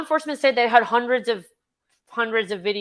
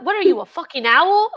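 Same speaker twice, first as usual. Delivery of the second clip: higher, louder mock outrage; wife mocking the hooting husband, louder; incredulous retort; 7 semitones higher, 7 dB louder than usual. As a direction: fire it back in disbelief. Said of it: "And she goes".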